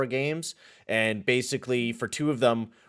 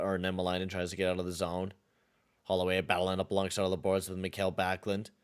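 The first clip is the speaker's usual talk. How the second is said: steady, slightly low play-by-play call; low-key announcer calling the action; hockey play-by-play; 3 semitones lower, 5 dB quieter than usual. Acted. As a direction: narrate the replay like a broadcaster. Calls the game video.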